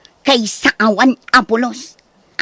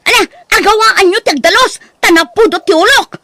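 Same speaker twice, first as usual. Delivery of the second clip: exasperated rant; 5 semitones higher, 3 dB louder than usual. Acted. Ranting after losing gambling.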